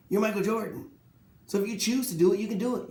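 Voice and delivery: ordinary talk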